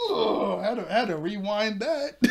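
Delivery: loud, high, delighted groan of admiration; thrilled. Real, not acted. Telling viewers about the great scene, excited loud gush. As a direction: gush about having to rewind.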